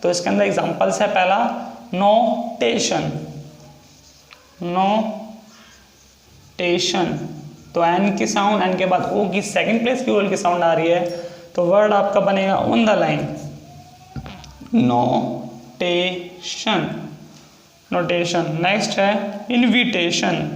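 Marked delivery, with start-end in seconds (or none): 1.9-3.1 slowed teaching pronunciation; slow drawn-out word. Shown as a demonstration. Teacher drawing out example word. 4.6-7.3 extremely slowed dictation; very slow stretched word. Shown as a demonstration. Teacher drawing out example word. 14.7-18.6 extremely slowed dictation; very slow stretched word. Shown as a demonstration. Repeats word while writing.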